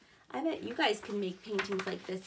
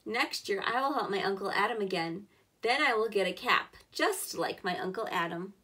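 louder, clear storybook reading; reading to children; 3 semitones lower, 4 dB louder than usual. Acted. Teacher reading the boy's workday to kids, louder storybook voice. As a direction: give every step of the workday a clear read. Reads picture book aloud.

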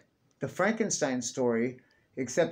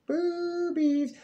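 high squeal. Real, not acted. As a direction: squeak the word in a silly high voice. Repeated outburst, higher voice.

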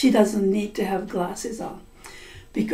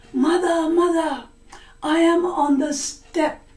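high daughter voice; 6 semitones higher than usual. Acted. Blood answers as the daughter.

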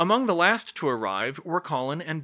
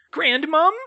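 very high, loud elf squeak; high-pitched, astonished squeak; 9 dB louder than usual. A narrator's reading. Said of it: "Sonia squeaked".

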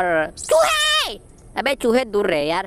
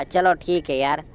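normal talking voice

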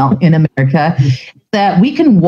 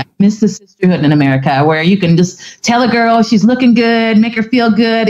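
ordinary talk, nothing marked

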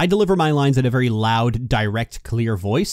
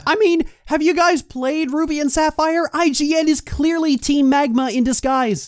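mock conspiracy voice; 12 semitones higher than usual. Joking to co-host that IGN is a villain team, in a high mock voice. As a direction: sell the joke as a wild-eyed theorist.

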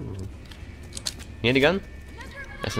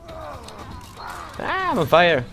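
panicked yelp; 14 semitones higher, 4 dB louder, much slower than usual. Real, not acted. Character burning in game.

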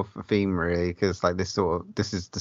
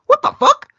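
sudden high shocked yell, much louder; 10 dB louder than usual. Retold or imitated. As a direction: blurt it out like the monster just appeared. Retold shock at monster.

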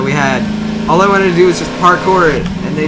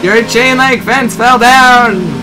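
mocking shout; 6 semitones higher, 4 dB louder, much slower than usual. Real, not acted. Mocking friend during race.

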